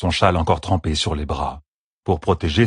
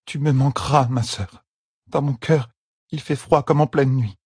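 sad Avienda farewell; 7 semitones higher than usual. A narrator's reading. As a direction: say goodbye to a sister.

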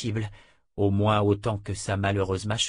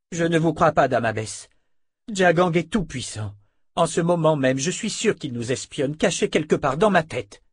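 higher, emphatic younger woman's character voice; agitated dark sister; 7 semitones higher, 5 dB louder than usual. Acted. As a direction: insist on it, pitched up and tense. Voices Alessandra in dialogue.

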